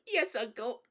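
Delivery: put-on high, tearful little boy; sobbing. A narrator's reading. Scolded nephew submitting to his uncle, sobbing. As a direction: give in through sobs. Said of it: "sobbed Caspian".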